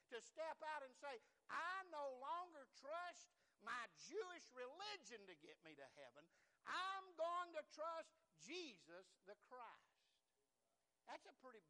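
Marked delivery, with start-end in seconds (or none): 1.5-10.0 loud, slow, resolute vow; defiant public confession; bold declaration. Acted. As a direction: stand up and declare it.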